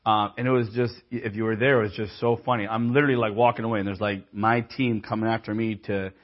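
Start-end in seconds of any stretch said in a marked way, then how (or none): none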